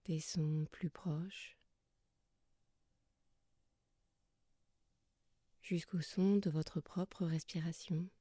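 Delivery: soft, calm yoga nidra guidance with long pauses; guided meditation. Acted. Yoga teacher settling listeners lying under blankets, soft guided meditation. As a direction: guide them gently into rest, leaving long silences between phrases.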